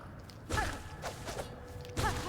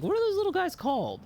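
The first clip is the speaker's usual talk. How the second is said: squeaky; faster than usual. Retold or imitated. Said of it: "I was like".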